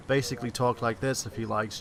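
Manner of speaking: attempted American accent; British speaker trying on an American accent. Shown as a demonstration. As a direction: put on a soft American accent. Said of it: "figured out my American accent".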